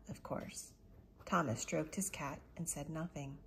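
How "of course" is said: soft unvoiced whisper; whispered aside. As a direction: breathe it as a sly whisper. Grandfather gently teases Thomas.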